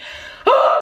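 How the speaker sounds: breathy gasp. Acted. Gasp after roof joke.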